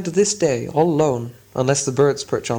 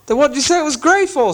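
very high, louder troll demand; angry troll; 12 semitones higher, 3 dB louder than usual. Acted. Bert confronting William, high angry troll. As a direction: demand an answer. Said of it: "said Bert".

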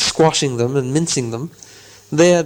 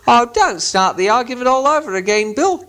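irritated troll; 9 semitones higher than usual. Acted. Bert scolding William about arguing, high troll voice. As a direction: tell Bill off wearily. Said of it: "he said".

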